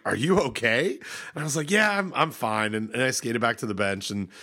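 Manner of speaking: ordinary talk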